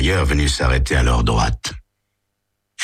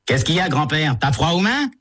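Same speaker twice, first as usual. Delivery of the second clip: put-on higher sneering teen; mocking taunt; 12 semitones higher than usual. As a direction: poke fun at the old man. Teen taunts angry driver.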